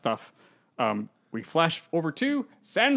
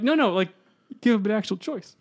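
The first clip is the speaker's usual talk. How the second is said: ordinary talk, nothing marked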